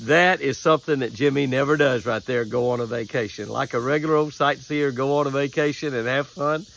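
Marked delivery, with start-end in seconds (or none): none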